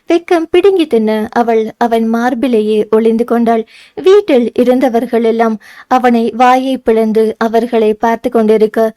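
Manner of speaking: ordinary delivery, nothing marked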